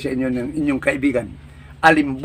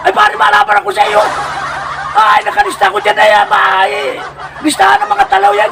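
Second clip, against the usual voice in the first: much higher, indignant buddy voice; challenging barkada; 13 semitones higher than usual. Acted. Friend insisting he's not afraid of the lister, high and indignant. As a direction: bristle at being called a coward.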